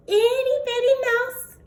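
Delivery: high squeaky storyteller voice; tiny mouse voice. A narrator's reading. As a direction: make the mouse sound itty bitty.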